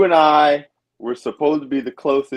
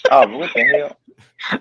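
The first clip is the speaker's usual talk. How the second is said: mock surprise; 4 dB louder, much slower than usual. Mock surprise at fake Jenny.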